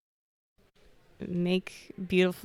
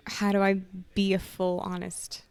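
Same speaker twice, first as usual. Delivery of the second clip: ordinary delivery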